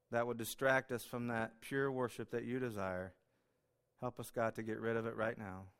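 quiet, earnest prayer voice. Real, not acted. Pastor praying over the church, softly. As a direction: pray softly and sincerely to God.

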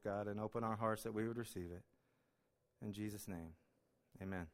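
soft, hushed pastoral prayer; earnest prayer. Acted. Pastor praying over his church before the sermon, hushed and earnest. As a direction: pray quietly over the congregation.

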